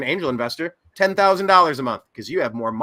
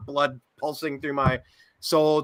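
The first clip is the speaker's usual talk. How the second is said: ordinary talk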